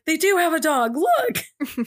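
high excited squeal; retelling her excited Snapchat; excited snap video. Retold or imitated. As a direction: gush over the dog, pitch way up. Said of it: "I was like".

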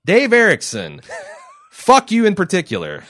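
mock fury at the show's creator; hammed-up cursing. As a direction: shout it out in playful outrage, with a laugh in it.

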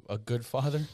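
ordinary delivery

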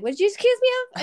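high, loud mock offended husband; indignant husband. Wife imitating her husband's offended retort. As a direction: play the husband, high and affronted. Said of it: "My husband be like".

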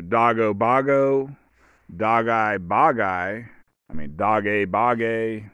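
slowly "doggo boggo", "dog eye bog eye", "dog a bog a"; swapped final vowels. Shown as a demonstration. Showing vowel options, endings drawn out slowly. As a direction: stretch each final oh, eye, ay. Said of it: "put any sort of vowel sound on there".

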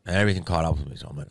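ordinary delivery

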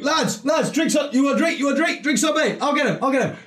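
high, brash lad calling the round; a seventeen-year-old showing off, buying everyone drinks; cocky teen in a bar. Retold or imitated. [name] shouting across the pub to his mates, high and brash. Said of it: "I'm walking into the bar going".

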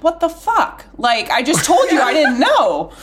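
exasperated inner outburst. Retold or imitated. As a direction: exclaim it in exaggerated exasperation, half laughing. Retold exasperated inner reaction.

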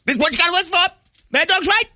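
piercing radio host screech; Mad Dog impression. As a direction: throw to the break screaming like a sports radio host. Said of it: "Mad Dog's up there screaming away".

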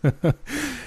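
strange high barked laugh; ugly barking laugh. Acted. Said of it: "barked a strange, ugly laugh".

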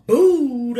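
loud, booming name drop; announcer's big stretched name; dropping the bomb. Shown as a demonstration. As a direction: hit the name hard and drag it out. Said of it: "got to drop the bomb".

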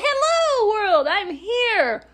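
high, slow, triumphant proclamation to the world; grand baby announcement. Retold or imitated. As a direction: announce your arrival like a conqueror. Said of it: "I was like".

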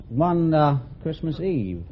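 ordinary talk, nothing marked

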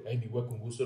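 low, quiet, solemn imitation; mock serious hello, voice dropped; serious deadpan greeting. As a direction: mimic a stone-faced friend greeting you. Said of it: "con un temple así serio".

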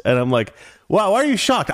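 ordinary delivery

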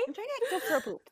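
cute mock imitation. Friend repeating the host's polite poop line, laughing high mimic. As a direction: tease the line back through giggles. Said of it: "you're so cute".

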